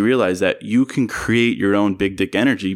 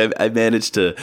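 ordinary talk

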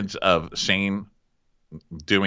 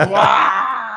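shocked wow; 12 semitones higher, 6 dB louder than usual. Real, not acted. Reaction to the burn.

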